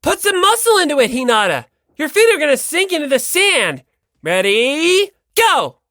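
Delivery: loud, high, energetic; loud high shouting; race start shout. Acted. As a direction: yell ready, go like a coach.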